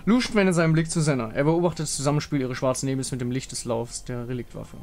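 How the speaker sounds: normal talking voice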